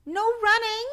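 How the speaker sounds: high, loud call after him. Acted. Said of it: "called after him".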